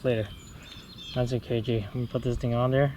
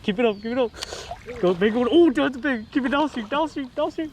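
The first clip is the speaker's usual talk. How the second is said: high-pitched, urgent coaching; excited urging; 9 semitones higher than usual. Real, not acted. Angler coaching buddy fighting a big fish, excited urging. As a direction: cheer them on, voice riding high.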